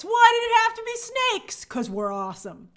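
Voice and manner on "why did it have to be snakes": higher and louder, hammed-up despair; playful mock-anguished cry; mock dramatic lament. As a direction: whine it like a film hero. Quoting the snakes movie line.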